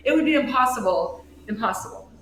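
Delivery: plain reading voice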